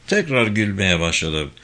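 ordinary delivery

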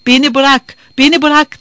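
high, loud shouted plea to be let go. A narrator's reading. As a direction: yell it to push her away. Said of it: "diye bağırdı".

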